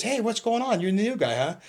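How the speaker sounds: neighboring dorm cop. Retold or imitated. Neighboring cop phones the new guy, imitated high-pitched. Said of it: "it was a neighboring dorm cop".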